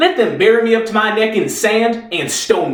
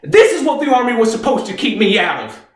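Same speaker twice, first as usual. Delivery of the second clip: high-pitched, anguished shouting; impassioned outburst; 7 semitones higher than usual. Acted. Reeves tells how the Army failed him, high, angry outburst. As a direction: build to a pained, shouted accusation.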